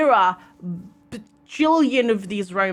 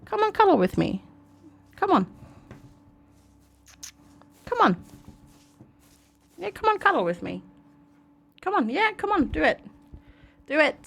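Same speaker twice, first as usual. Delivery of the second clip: higher, coaxing voice to a pet; coaxing the cat; 5 semitones higher, 4 dB quieter than usual. Real, not acted. Owner coaxes her cat to cuddle, high and coaxing. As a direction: sweet-talk the kitty over.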